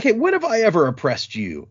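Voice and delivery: a mock-offended noble asking when she ever oppressed him; indignant protest. Mock-offended question from a noble to her guard. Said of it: "she's like".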